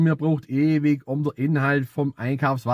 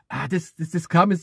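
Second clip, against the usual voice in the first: ordinary delivery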